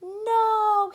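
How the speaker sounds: put-on high, loud yell of a distraught little kid. A narrator's reading. Little boy seeing his torn toy, anguished yell. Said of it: "he yelled".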